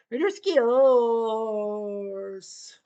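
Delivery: long stretched announcer yell; drawn-out goal call. Acted. Announcer calling a goal, drawn-out stretched yell. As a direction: stretch the team name out like a big goal call.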